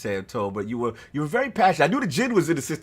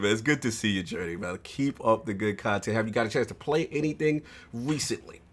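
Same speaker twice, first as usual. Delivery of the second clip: normal talking voice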